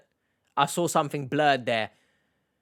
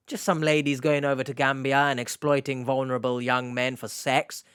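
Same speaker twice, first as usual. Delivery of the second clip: ordinary talk, nothing marked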